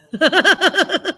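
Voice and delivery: hearty high burst of laughter; loud laugh. Real, not acted. Laughing at the story's end.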